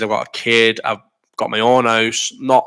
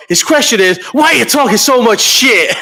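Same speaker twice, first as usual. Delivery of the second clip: mock McGregor; 10 semitones higher, 3 dB louder than usual. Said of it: "And then McGregor goes".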